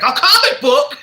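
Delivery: high, loud hammed-up exclamation; mock astonishment. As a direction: blurt it out in exaggerated disbelief. Retold line, exaggerated second take.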